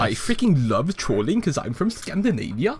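playing a boastful Norwegian bridge troll in a Scandinavian accent. Troll King bragging about Norway, in a hammed-up Scandinavian accent. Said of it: "Scandinavian accent".

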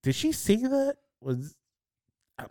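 high, disbelieving questions; unsure he heard right; baffled friend. Retold or imitated. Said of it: "my friend goes like".

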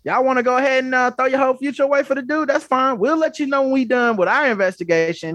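high, fast mock official voice; mocking NFL official. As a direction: parody the league talking down. Voicing the NFL's reply.

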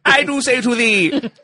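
high, loud, slow proclamation; hammed-up castle crier selling wares; mock medieval herald. Mock medieval castle sponsor pitch.

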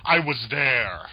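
normal talking voice

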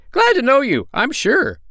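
very high, piping little bird voice; high-pitched storybook bird; little sandpiper. A narrator's reading. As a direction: squeak it out as a tiny polite sandpiper.

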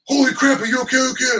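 mock-panicked high check-in; alarmed director. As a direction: yelp it out like he just saw a bad fall. Director reacting to hard fall.

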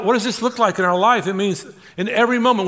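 usual speaking voice

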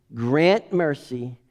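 ordinary talk, nothing marked

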